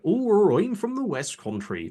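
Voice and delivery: broad West Country burr, swooping high at the start; West Country accent. Shown as a demonstration. Player shows off his go-to game accent, West Country. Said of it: "like I say, I do".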